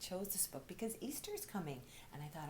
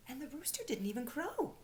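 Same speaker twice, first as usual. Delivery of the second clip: plain reading voice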